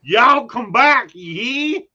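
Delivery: hammed-up Southern send-off; Southern accent. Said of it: "someone from New York wanting to be Southern".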